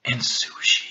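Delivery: normal talking voice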